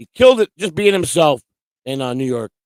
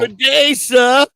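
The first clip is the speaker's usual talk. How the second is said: hearty greeting; 4 semitones higher, 6 dB louder, much slower than usual. Real, not acted. Greeting a Discord friend.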